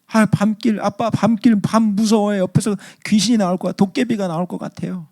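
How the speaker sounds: put-on high-pitched frightened kid voice; scared child. Child to dad on a night road, acting scared. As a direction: plead anxiously like a little child. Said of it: "그렇게 하면서 무서워해요".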